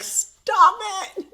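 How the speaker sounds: high-pitched retold outburst; mock exasperated cry. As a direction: cry out at a partner in playful horror. Retold scolding of toenail-ripping boyfriend.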